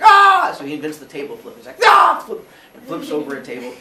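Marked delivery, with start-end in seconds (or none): 0.0-0.5 high, loud yell of rage; frustrated scream. Acted. Zeus frustrated, table flip. 1.8-2.2 high, loud yell of rage; frustrated scream. Acted. Zeus flips the table.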